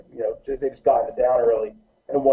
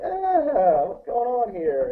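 put-on confused teen girl; 9 semitones higher, much slower than usual. Teenage girls confused at show.

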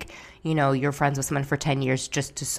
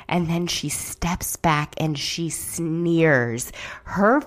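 normal talking voice

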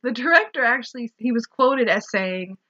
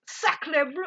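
put-on half-hearted French accent, said softly; a shy stab at a French accent; mock French accent; 6 semitones higher, 6 dB quieter than usual. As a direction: sacre bleu in a quiet, tentative French accent. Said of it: "take a shot at that accent".

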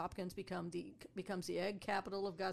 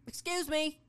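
high, loud polite call; calling out to get attention; 9 semitones higher, 9 dB louder than usual. Retold or imitated. Customer calling to the guide, loud and high.

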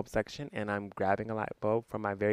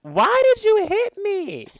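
put-on very high, slow, whiny child's plea; boy asking his grandma why she hit him, very high and slow; confused little boy; 10 dB louder than usual. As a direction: plead like a hurt, baffled little boy. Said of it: "the little boy literally was like".

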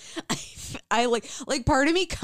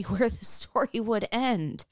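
ordinary delivery

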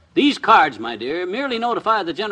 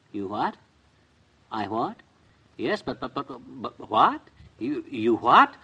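low, quiet stutter rising to questions; stunned stammering; 7 semitones lower, 4 dB quieter, slower than usual. Acted. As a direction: sputter in disbelief into the phone. Stunned by phone company's news.

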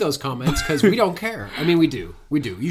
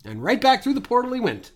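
narrator voice; 10 semitones higher than usual. Acted. Narrator tag after character exits.

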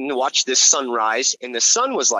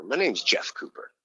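ordinary delivery

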